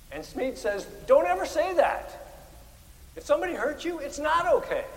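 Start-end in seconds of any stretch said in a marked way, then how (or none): 1.1-4.8 high, forceful admonition; quoted rebuke with rising intensity; emphatic correction. Retold or imitated. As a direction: insist firmly, voice pitched well up. Quotes ethicist firmly contradicting the brush-off.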